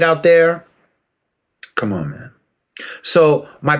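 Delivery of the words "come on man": weary come on. Real, not acted. Exasperated aside.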